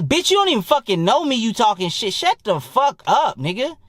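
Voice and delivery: high-pitched, worked-up comeback; indignant retort. Retold or imitated. Snapping back at a trash-talker on the court, indignant. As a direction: tell him off, drawing out the shut-up. Acts out his retort in a quarrel.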